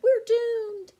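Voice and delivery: very high, loud wail of doom; panicked shriek. A narrator's reading. Dinosaurs panicking in the den, shrieking loud and high. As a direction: shriek in terror. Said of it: "they cried".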